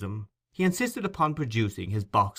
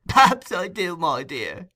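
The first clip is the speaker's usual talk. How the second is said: high, louder chuckling reply; shrill chuckle; 8 semitones higher, 7 dB louder than usual. A narrator's reading. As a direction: chuckle the words out slyly. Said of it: "with a shrill chuckle".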